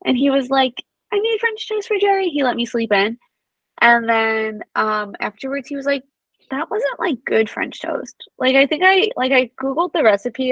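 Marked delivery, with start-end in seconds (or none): 1.1-2.3 high, cheery proud quote; proud announcement. Retold or imitated. Husband proudly announcing breakfast for the baby, high cheery voice. 6.5-8.3 sheepish admission. Retold or imitated. Husband admitting failure.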